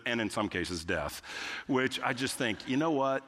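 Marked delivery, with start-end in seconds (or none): 0.0-1.2 low, offhand side-effect warning; drug commercial disclaimer. Retold or imitated. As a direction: slip the grim warning in low. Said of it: "they all end with".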